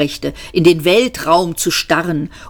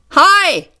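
bright, much higher and louder greeting; cheery high hi; 12 semitones higher, 4 dB louder than usual. Acted. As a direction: toss off a breezy hello. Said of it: "sagte er". (fröhlich hohes Hallo)

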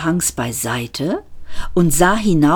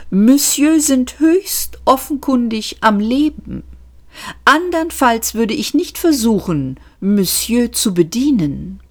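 higher, thin-lipped sarcasm; a touch of prim waiter sarcasm; sarcastic waiter; 7 semitones higher than usual. Acted. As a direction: condescend to the guest. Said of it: "schmallippigen, sarkastischen kleinen Kellners".

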